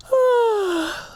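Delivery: high, drawn-out yawning sigh; big yawn. Acted. Tired bear yawns during intermission. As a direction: yawn it out hugely.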